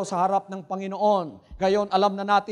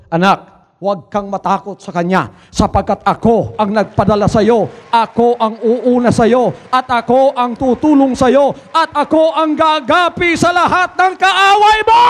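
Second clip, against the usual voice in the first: voice of the Lord; 8 dB louder than usual. Retold or imitated. The Lord encouraging the frightened preacher, booming proclamation. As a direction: proclaim it as God reassuring his son. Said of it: "sabi ng Panginoon".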